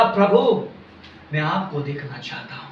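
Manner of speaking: very low, soft acted petition; hushed plea. Blind Bartimaeus answers Jesus, hushed plea. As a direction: plead quietly, almost under the voice.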